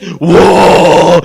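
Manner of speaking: loud, drawn-out mock roar; booming mock roar. Preacher imagines God showing off his might, loud roar. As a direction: imitate an almighty display with a big whoa. Imitates God's mighty display.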